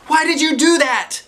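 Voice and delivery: put-on loud high angry kid; high shouted angry question; hollered. A narrator's reading. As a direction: holler it at your friends. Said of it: "she hollered".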